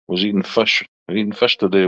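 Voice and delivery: ordinary delivery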